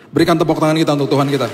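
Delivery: loud, fast call to applaud; rallying the church to clap for God; preacher's rallying call. Acted. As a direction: rouse the congregation. Calls for applause.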